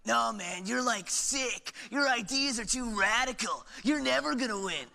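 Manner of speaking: Gerald's voice. Acted. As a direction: play the skeptical friend. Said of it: "Hey, Gerald".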